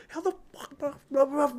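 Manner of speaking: high-pitched mock outrage; mock yell at TV. Fan yelling at the TV over the ref, mock yell. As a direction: squeal it like yelling at the screen. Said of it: "I was screaming at the T V".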